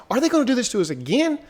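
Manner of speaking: high, rushed disbelief; incredulous outburst. Retold or imitated. Viewer reliving dread of another cliffhanger, high incredulous protest. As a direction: pitch it way up and race through the complaint.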